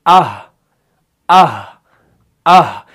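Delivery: आह ending in a stop deep in the throat; throaty aah. Shown as a demonstration. Teacher shows where हा is made. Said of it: "वहां पर हा का मखरज है".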